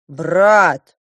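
loud, drawn-out address; emphatic, stretched appeal; appealing to brother. A narrator's reading. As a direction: call your brother's attention firmly. Younger prince appeals to brother.

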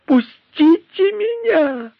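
очень высоко, медленно, надрывно кричит; вырывающийся вопль с плачем; истошный крик. Acted. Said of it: "раздался истошный крик вдовы". (вдова задумавшегося истошно кричит, рвётся к предателю)

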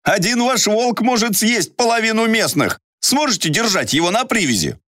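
high, wary gatekeeper voice; a stubborn guard at the gate; suspicious guard voice. Acted.

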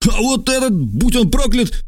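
high, cursing cry; shouting accusation. A narrator's reading. Stranger denounces Trasul to the lictors, high angry shout. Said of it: "крикнул незнакомец".

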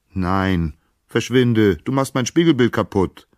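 loud, high, indignant; angry rejection. A narrator's reading. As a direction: chase her away crossly. Narcissus angrily rejects Echo.

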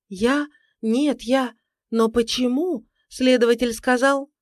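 high, halting, rattled protest; flustered shock. A narrator's reading. Wife questioned by her living husband, flustered shock. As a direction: stumble through it, shaken.

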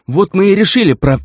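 sentence cut off abruptly; cut off mid word. A narrator's reading. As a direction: stop dead mid word. Orc cut off by attack.

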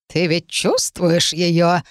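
very slow sly crone; thin reedy voice. Acted. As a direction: draw it out like a knowing witch. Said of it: "Старуха неожиданно рассмеялась".

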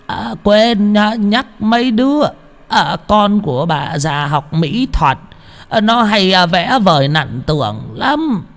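high, slow, drawn-out granny call; elderly woman's sing-out from below; old woman calling up. A narrator's reading. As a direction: call upstairs like an old crone. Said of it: "Bà lão ở dưới bếp nói vọng lên".

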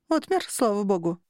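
ordinary delivery, nothing marked

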